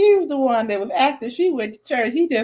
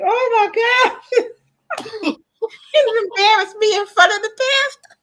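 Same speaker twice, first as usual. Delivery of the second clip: grandmother shocked; 13 semitones higher than usual. Retold or imitated. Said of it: "my grandmother was like".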